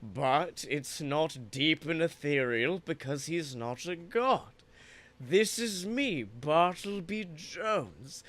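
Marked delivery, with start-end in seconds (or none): 5.2-8.2 slow Bartleby voice. Shown as a demonstration. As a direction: draw each word out slowly. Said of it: "It has the same pace".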